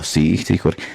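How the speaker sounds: sí sumiso y burlón, más grave y suave; falsa sumisión. Retold or imitated. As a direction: fingir que acepta todo con mansedumbre. Imita cómo respondería sumiso. (a Jorge, fingiéndose sumiso con burla)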